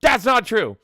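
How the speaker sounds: high, loud mock objection; imitated loud protest. Host voicing a chatter disputing who was first, mock shout. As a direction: shout it as a put-on denial. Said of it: "J T E is like".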